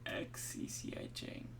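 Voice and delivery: slow whisper, letter by letter; slow, hushed spelling-out. Real, not acted. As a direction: whisper each letter as you puzzle it out. Spelling out letters under his breath.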